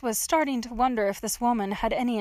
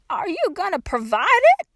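put-on high, louder old-lady retort; challenging old woman; 9 semitones higher, 7 dB louder than usual. A narrator's reading. Old woman argues with trapped girl, high and challenging.

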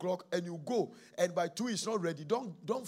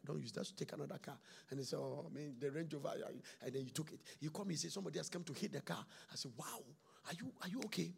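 soft, gentle phone talk; telling of a calm call with his son about the car; quiet retelling; 10 dB quieter, faster than usual. Retold or imitated. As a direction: hush it, calm and reassuring.

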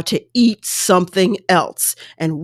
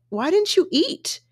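mild concern; 11 semitones higher than usual. Retold or imitated. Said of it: "He literally was like".

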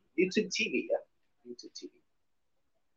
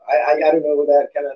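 ordinary talk

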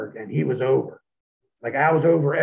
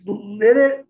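ordinary talk

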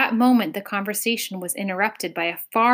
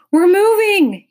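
shout; 12 semitones higher, 11 dB louder, slower than usual. A narrator's reading. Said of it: "he shouted".

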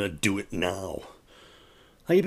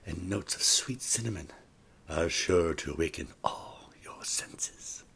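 soft ad read; 4 semitones lower, 7 dB quieter than usual. Acted. Ad-copy read, whisper at end.

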